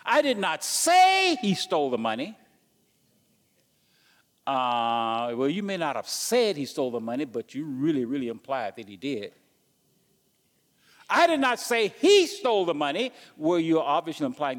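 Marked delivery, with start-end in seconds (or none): 0.0-2.4 "say" stretched and stressed, louder, rising. Shown as a demonstration. Preacher showing the flock how "say" changes meaning, stressed. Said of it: "You see how you can say different things". 11.1-13.1 "he" punched, higher and louder; stress on he. Shown as a demonstration. Preacher demonstrating to the church stress on "he". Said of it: "You see how you can say different things".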